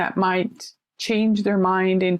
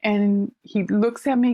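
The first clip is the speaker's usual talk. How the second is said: normal talking voice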